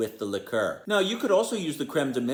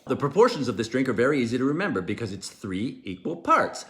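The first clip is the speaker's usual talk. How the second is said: ordinary talk, nothing marked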